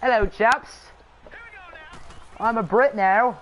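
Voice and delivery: amateur put-on British accent. Shown as a demonstration. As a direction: play at being a Brit. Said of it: "Do a British accent".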